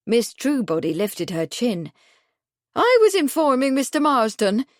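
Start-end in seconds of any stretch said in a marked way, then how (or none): 2.8-4.7 chilly tone. A narrator's reading. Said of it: "in a chilly tone".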